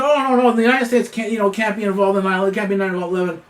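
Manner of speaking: imitating people who deny it; mock naive denial. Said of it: "And people say".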